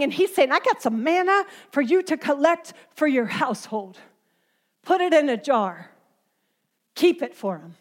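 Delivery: preaching. Acted. Preacher rebuking a grumbling congregation, earnest preaching. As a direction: press the point home to the crowd.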